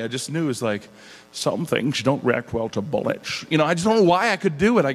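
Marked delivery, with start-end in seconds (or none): 1.3-3.5 slow Scottish film-star impression; slushy s sounds, slowly measured out; Sean Connery. Impressionist showing off a voice, slow Sean Connery impression. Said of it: "when I heard Sean Connery".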